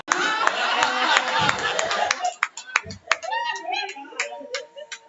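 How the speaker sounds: a long, loud, high burst of laughter; loud high cackle. Real, not acted. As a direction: cackle hard at her own joke. Big laugh after a crude joke.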